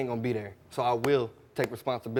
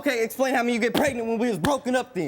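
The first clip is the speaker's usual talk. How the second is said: high, heated demand; angry challenge; 9 semitones higher than usual. Real, not acted. Shouting match with girlfriend.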